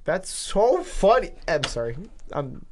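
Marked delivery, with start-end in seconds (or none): 0.1-1.3 amused. Real, not acted. Still overcome by the funny episode.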